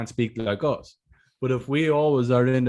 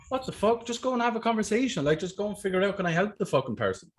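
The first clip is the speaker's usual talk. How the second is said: high, fast exasperated outburst; exasperated inner voice; 9 semitones higher, faster than usual. Retold or imitated. Boss mocking a rep's overthinking, high exasperated. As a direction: pitch it way up, fed up and incredulous. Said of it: "You're like".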